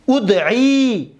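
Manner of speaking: teacher modelling a verb form slowly; drawn-out model. Shown as a demonstration. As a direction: hold every sound of ادعی for the class.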